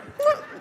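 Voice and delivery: very high short laugh; delighted high laugh; high squeal of laughter. Real, not acted. Laughing at grandma.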